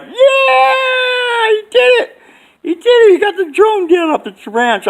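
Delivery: very high, loud shouted cheering; cheering crowd. Retold or imitated. Onlookers at the boat ramp cheering the hero, high excited shouting. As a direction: scream it like onlookers going wild. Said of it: "the people in the background were like".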